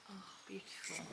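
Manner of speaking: breathy whisper of wonder; whispering to the flowers; hushed admiration. Real, not acted. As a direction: breathe it out in awe. Admiring tulips softly.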